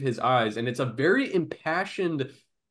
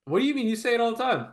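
much higher, startled disbelief; what do you mean, pitched way up; incredulous; 9 semitones higher, much faster than usual. Real, not acted. A friend shot back at, in high disbelief.

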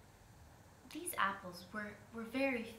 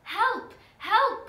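loud, high-pitched call for help; pleading cry for help; 13 semitones higher, 14 dB louder than usual. A narrator's reading. Stuck spider begs the girl for help, pleading loudly. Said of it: "pleaded the spider".

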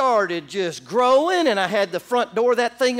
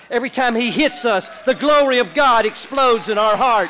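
louder and louder, punching hits; rising preaching; 2 semitones higher, 6 dB louder than usual. Real, not acted. Preacher driving home the point to the church, louder and louder. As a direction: build the climax, each hits harder.

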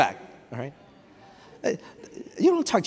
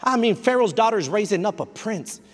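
ordinary delivery

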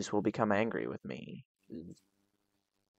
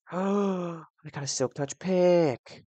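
excited gasp; 6 semitones higher, 3 dB louder, much slower than usual. Real, not acted. Gasps at looted pickaxe.

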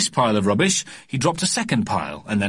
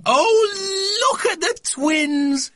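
excited cry; much slower than usual. A narrator's reading. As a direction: squeal over the babies. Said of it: "she cried".

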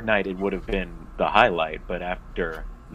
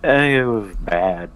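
slow blunt verdict; 2 semitones higher, 6 dB louder, slower than usual. Real, not acted.

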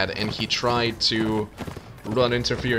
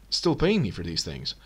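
usual speaking voice

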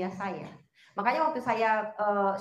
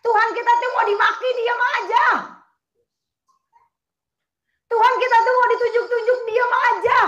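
preaching shout; 11 semitones higher, 12 dB louder than usual. Acted.

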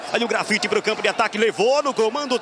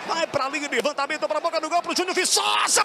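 ordinary talk, nothing marked

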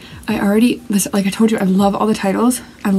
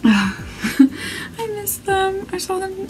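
wistful; 11 semitones higher, 5 dB quieter, much slower than usual. Real, not acted. Fan missing her favourite group.

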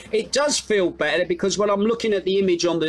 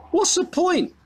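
exasperated; 14 semitones higher than usual. Real, not acted. Mocking a ridiculous rule.

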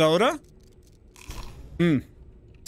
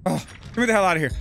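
ordinary talk

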